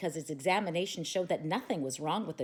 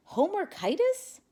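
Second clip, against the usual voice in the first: high, rising puzzled echo; 13 semitones higher, 4 dB louder than usual. A narrator's reading. Boy echoing the vet's diagnosis, high and puzzled. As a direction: repeat the odd word in disbelief. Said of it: "A puzzled Stevie responded".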